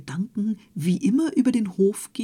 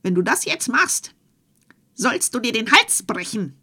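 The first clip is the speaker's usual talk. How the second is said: goblin's threat; 4 semitones higher, 5 dB louder than usual. Acted.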